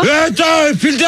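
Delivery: high, indignant shout; angry interjection. Real, not acted. Host breaks into the quarrel, angry high shout.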